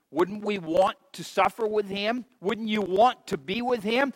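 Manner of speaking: ordinary delivery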